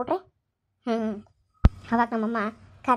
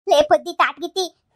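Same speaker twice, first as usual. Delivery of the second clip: loud, slow scold; angry scolding call; 6 dB louder than usual. Acted. Grandmother berates daughter-in-law, loud and slow. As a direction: bark the insult slowly.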